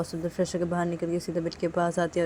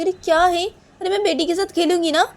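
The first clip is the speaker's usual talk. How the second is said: loud, much higher-pitched whinging; exasperated protest; 12 semitones higher, 10 dB louder than usual. Acted. Nisha wanting to play with the baby, protesting shrilly. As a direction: protest loudly at being refused.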